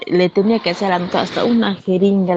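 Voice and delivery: ordinary talk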